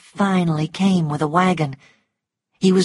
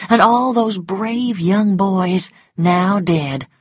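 ordinary delivery

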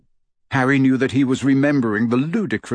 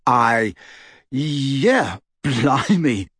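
halting, astonished acceptance; overwhelmed; much slower than usual. A narrator's reading. Harry accepting the godfather role, overwhelmed. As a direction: falter word by word, stunned and pleased. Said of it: "Harry felt overwhelmed, astonished, delighted".

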